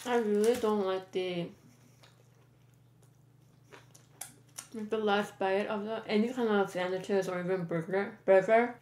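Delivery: chewing while talking; muffled by food; mouth full. Real, not acted. Seen 2: Holds last sandwich piece, bites at 3.7s, chews while speaking.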